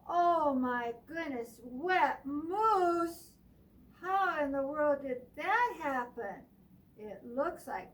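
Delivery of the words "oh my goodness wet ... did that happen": loud astonished gasp of words; surprised. A narrator's reading. Reader to children, loud surprise. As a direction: act shocked for the kids.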